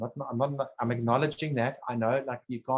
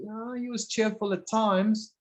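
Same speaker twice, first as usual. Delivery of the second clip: higher, drawn-out quoted remark; mock casual assessment; 9 semitones higher than usual. Therapist voicing a vague view of the other guy, mock-casual. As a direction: toss it out as someone else's slow, high-pitched verdict. Acts out a dismissive summary.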